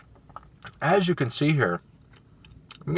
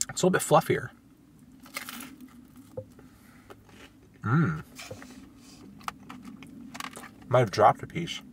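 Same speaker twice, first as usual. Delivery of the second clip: talking between bites of pizza; chewing while talking; 3 dB quieter than usual. Real, not acted. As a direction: mouth partly full. Seen 0: bites just before, closed-mouth chewing frames during words, pizza in hand.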